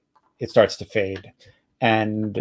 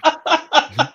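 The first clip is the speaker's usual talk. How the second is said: high laugh. Real, not acted. The GM laughing at his own accent, high laugh. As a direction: burst of high embarrassed laughter. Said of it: "Can't do it".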